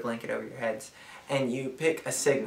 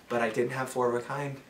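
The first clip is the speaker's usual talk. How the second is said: normal talking voice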